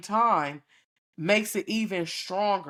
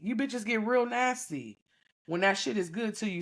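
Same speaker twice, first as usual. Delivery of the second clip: ordinary talk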